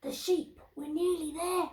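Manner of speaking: high storybook crook; high-pitched creeping thief; sneaking thief. Acted. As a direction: say it like an eager burglar. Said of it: "said Len".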